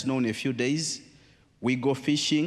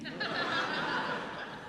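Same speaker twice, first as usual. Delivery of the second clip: a short breathy laugh at his own slip; soft laugh; 8 dB quieter than usual. Real, not acted. A preacher laughs at his own ship-fish slip, softly. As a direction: laugh quietly at the joke.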